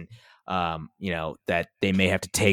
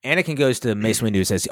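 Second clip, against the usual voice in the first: ordinary delivery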